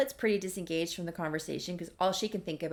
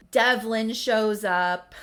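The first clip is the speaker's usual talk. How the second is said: dramatic announcement; 3 semitones higher, 10 dB louder, much slower than usual. A narrator's reading. Dramatic narration of arrival.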